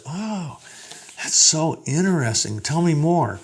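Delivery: gushing, high and interested; eager golden retriever. Shown as a demonstration. Teacher voicing the golden retriever, gushing with interest.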